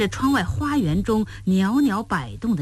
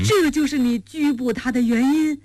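higher, teasing question through a grin; grinning; 7 semitones higher than usual. A narrator's reading. Qiao Tai asking his master about the arrest, grinning. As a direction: ask it with a broad grin. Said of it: "乔太咧嘴笑道".

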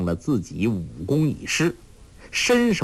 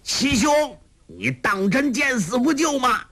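much higher, carrying shout; villain calling for rescue; calling from afar; 12 semitones higher than usual. A narrator's reading. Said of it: "欧阳锋远远地叫道".

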